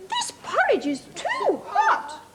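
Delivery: put-on very high, slow wee baby bear voice. Teacher reading to child as tiny Baby Bear, very high.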